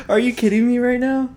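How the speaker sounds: high, laughing are you kidding me; laughing disbelief. Real, not acted. Host laughing at her cat interrupting the recording. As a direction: giggle through the protest.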